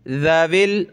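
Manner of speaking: slow chanted recitation; teacher chanting the verse for students; Quran recitation. Shown as a demonstration. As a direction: recite slowly with a melodic lilt.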